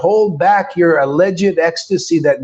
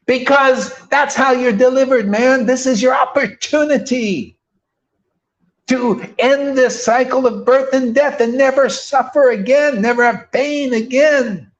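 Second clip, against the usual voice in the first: higher, pressing preacher's urging; emphatic preaching; 6 semitones higher than usual. Real, not acted. Preacher urging listeners toward deliverance, emphatic and higher. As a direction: drive it home like a preacher at full force. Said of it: "emphatic in this preaching".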